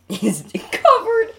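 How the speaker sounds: laughing. Real, not acted. Laughs at covered marshmallow.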